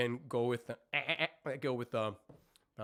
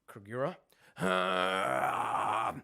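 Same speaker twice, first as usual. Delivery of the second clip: 1 mock scream; 7 semitones lower, 4 dB louder, much slower than usual. Shown as a demonstration. Vocal coach demos a scream.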